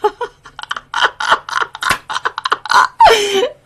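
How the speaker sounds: high peal of real laughter; a real laugh that soars; big rising laugh. Real, not acted. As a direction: burst out laughing, climbing up. Laughing at her own story.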